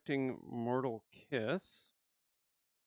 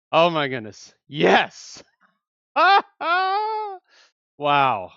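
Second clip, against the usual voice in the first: loud, higher cry of delight; joyful squeal; 6 semitones higher, 16 dB louder, much slower than usual. Real, not acted. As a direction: let the joy burst out. Celebrating the pull.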